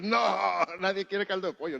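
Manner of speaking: loud, high laughing protest; mock protest. Preacher acting the family objecting to the aunt, loud mock protest. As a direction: push back on the aunt's order.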